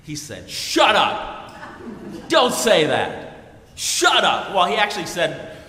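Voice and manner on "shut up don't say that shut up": loud, angry New Yorker yelling; mock barroom shout of shut up. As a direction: bark it back like an angry drunk. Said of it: "he used some New York language".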